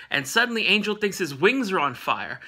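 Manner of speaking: normal talking voice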